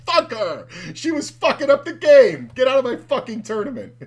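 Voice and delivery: high, loud retold rant; gleeful mock outrage. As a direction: yell it in hammed-up indignation. Retells own outburst amid laughter.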